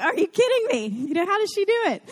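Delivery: high, incredulous outburst; can't believe how she does it; marvelling at Mary Ann, high and incredulous; disbelief. Retold or imitated. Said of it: "I'm like".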